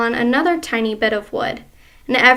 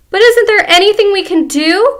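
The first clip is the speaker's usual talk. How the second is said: higher, louder pleading; frightened cry; 8 semitones higher, 9 dB louder than usual. Acted. Wife begging husband for a cure, higher and louder. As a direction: beg desperately. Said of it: "cried Missus Twit".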